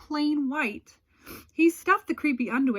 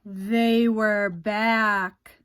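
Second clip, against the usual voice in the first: very slow, louder, ominous; dramatic reveal; 4 dB louder, much slower than usual. A narrator's reading. Narrator springing the twist, very slow and louder. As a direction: drop the scary twist heavily.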